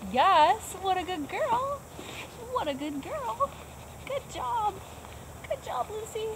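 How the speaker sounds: high, soft sing-song praise; dog praise voice. Real, not acted. Trainer praising her dog Lucy, high soft sing-song.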